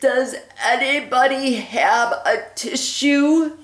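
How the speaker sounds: a tiny flea begging for a tissue; garbled plea. Acted. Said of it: "his garbled plea".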